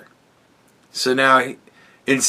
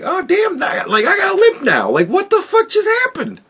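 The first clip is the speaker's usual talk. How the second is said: much higher, half-laughing disbelief at a sudden limp; squeaky, incredulous "what just happened", with a laugh; shocked disbelief. Retold or imitated.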